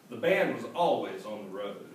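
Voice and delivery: ordinary delivery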